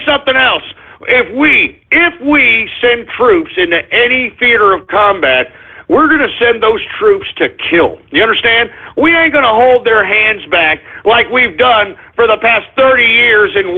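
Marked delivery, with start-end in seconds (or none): none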